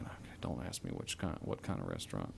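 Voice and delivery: whispered low aside to himself; inner thought whisper. Retold or imitated. Thinking to himself during questioning, hushed whisper. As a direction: breathe it like a private worry.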